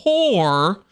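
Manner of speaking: poor drawn out with a rising tune; stressed poor. Shown as a demonstration. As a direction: give poor a long mocking rise. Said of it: "emphasis on the word poor".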